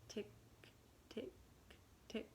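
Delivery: ordinary delivery, nothing marked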